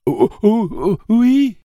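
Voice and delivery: very high, loud sobbing yes; terrified child. A narrator's reading. Jake answering Tic-Tac, terrified and sobbing. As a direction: squeal it out in terror.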